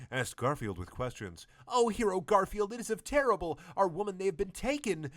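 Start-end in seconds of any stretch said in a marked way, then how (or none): 1.7-5.1 high, loud wailing villager voice; much higher hammed-up lament; crying villagers. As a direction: wail for the stolen women. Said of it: "cried the villagers in sadness".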